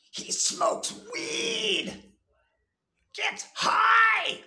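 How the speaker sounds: hammed-up high shout; mock hysterical yell. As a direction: ham up a panicked snitch shouting. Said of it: "and says".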